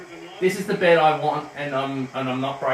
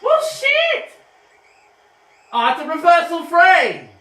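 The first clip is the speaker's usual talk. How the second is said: furious shout, much higher and louder; outraged shout; 13 semitones higher, 10 dB louder, slower than usual. Real, not acted. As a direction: yell at the umpire call. Outraged at umpire decision.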